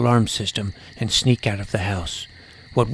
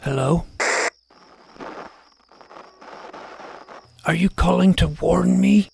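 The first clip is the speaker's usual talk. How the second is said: anxious calling; 7 semitones higher than usual. Acted.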